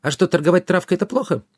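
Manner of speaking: high-pitched fairy question; naive fairy. A narrator's reading. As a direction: ask innocently in a high little voice.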